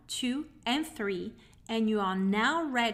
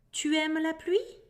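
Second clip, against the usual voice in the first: tu aimes la pluie said a bit higher with a rising tune on "pluie"; question rise; 6 semitones higher than usual. Shown as a demonstration. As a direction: lift "pluie" like a question mark. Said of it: "you can also hear".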